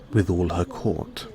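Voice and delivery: ordinary delivery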